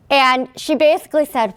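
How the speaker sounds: normal talking voice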